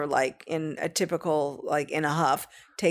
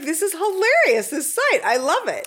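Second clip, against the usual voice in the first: a much higher, louder delighted gush; excited daughter; 9 semitones higher, 4 dB louder than usual. Retold or imitated. Mother quoting her daughter's delighted reaction, excited. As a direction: echo her teen delight at the site. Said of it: "and she's like".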